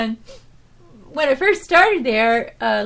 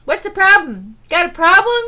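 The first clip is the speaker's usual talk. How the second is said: confrontational customer; 6 semitones higher, 8 dB louder than usual. Retold or imitated. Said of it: "he goes".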